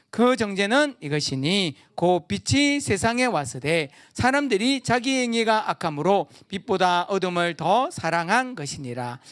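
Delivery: formal Bible recitation; scripture reading. Acted. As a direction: read the verse with solemn weight. Reads scripture aloud.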